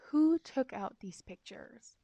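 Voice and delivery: slow, lightly imitated interrogation; interrogating headmistress. Headmistress questions a silent class, mildly mock interrogation. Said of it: "then she starts interrogating us".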